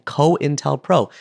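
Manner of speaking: "cointelpro" said slowly, syllable by syllable: coin-tel-pro; spelled-out word. Shown as a demonstration. Spelling a new word for listeners, slow and careful. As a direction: draw out "cointelpro" slowly, falling at the end. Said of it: "T E L P R O".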